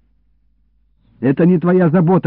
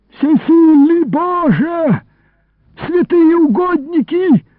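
wailing imprecation; 9 semitones higher, 3 dB louder than usual. Acted. Man trapped in a jug calling on God, wailing imprecation. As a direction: cry to heaven as you curse.

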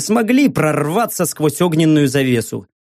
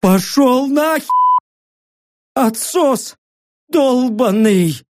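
high, slow gurgle of a dying man; gurgling dying voice; 7 semitones higher, much slower than usual. A narrator's reading. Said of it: "пробулькал умирающий пилот".

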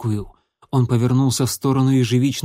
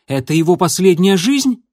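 urgent, high, loud shouting; 6 semitones higher, 6 dB louder than usual. A narrator's reading. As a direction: demand an answer, yelling. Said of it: "крикнул он".

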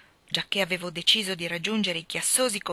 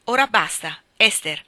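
louder firm reprimand; stern command; 8 dB louder than usual. Acted. Mother silences her insolent daughter, stern reprimand. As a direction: put an end to it firmly.

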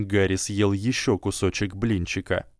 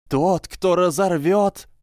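high, slow, loud ethereal boom; booming prophecy voice; 10 semitones higher, 4 dB louder than usual. A narrator's reading. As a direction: thunder the prophecy like a trance. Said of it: "прогремел гулкий голос".

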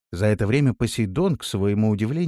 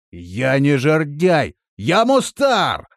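slow, loud, high shout; shouted correction; 5 semitones higher, 6 dB louder, much slower than usual. A narrator's reading. Companion correcting his name down the well, shouted. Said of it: "крикнул в колодец".

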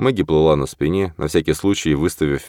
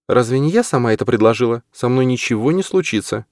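higher female character voice; Maggie voice; 8 semitones higher than usual. Acted. Maggie's lines in the story.

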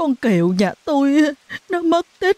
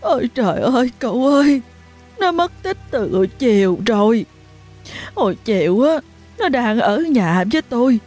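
distraught mother; 7 semitones higher than usual. A narrator's reading. Mother pleading with neighbour about missing daughter, distraught. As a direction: beg for help, voice breaking.

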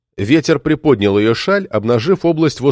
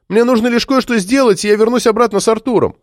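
put-on high-pitched girl's entreaty; pleading girl; 11 semitones higher than usual. A narrator's reading. Marta promises to return with Arthur, high pleading voice. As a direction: plead high, hurrying at the end.

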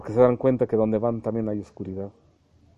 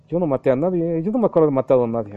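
fast, higher, louder denial of a dead man; protesting innocence; 5 semitones higher, 4 dB louder, faster than usual. Acted. Dead man protests to the judge, fast and high. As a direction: blurt out the denial in a panic. Dead soul denies killing anyone.

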